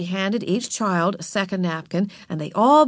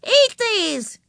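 put-on high-pitched child's order; demanding little girl, high yell; shouted command. A narrator's reading. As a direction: shout it bossily. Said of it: "she shouted".

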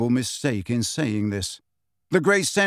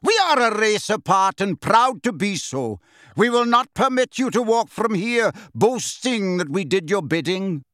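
raised, indignant declaration; proud anger; 6 semitones higher than usual. A narrator's reading. Centaur proud of his race, indignant.

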